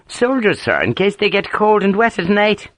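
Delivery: mock-earnest Ulster ribbing; amused Ulster accent. A narrator's reading. Sergeant teasing his grumbling officer, in amused Ulster accent. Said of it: "Harper's thick Ulster accent was rich with amusement".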